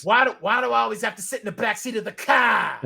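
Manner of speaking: hammed-up Boston accent; bad Boston accent. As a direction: complain in broad Boston, stretching car. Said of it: "saying things in a bad Boston accent".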